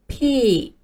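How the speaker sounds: "P" with a stretched-out vowel; lengthened P. Shown as a demonstration. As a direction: draw out the sound of "P" slightly. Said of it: "声音要稍微的拉长一些".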